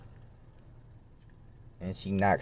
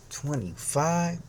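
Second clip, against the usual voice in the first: higher, slow, stretched question; disbelieving question; 7 semitones higher, 4 dB quieter, much slower than usual. Retold or imitated. Disbelieving echo of cashier's guess.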